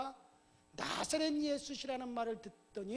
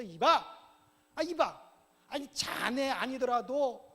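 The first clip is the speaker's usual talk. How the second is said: loud, irritated telling-off; scolding; 3 semitones higher, 6 dB louder than usual. Acted. Passer-by kicks at noisy blind beggar, scolding him. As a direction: raise your voice to hush a nuisance.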